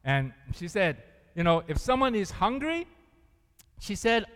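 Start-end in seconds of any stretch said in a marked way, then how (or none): none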